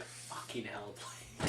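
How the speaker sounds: muttered curse. Retold or imitated. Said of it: "I was like".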